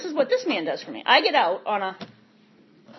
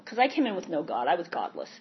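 ordinary talk, nothing marked

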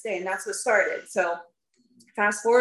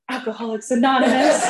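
loud, higher mock blurt with laughter; comically over-eager answer, raised voice; 4 semitones higher, 5 dB louder than usual. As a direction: blurt it out loudly, half laughing. Blurting answer to annoyed friends.

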